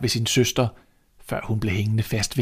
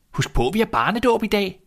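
much higher urgent call; 10 semitones higher than usual. Acted. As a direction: remind him, calling after. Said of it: "råbte han".

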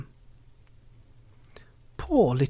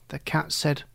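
plain reading voice

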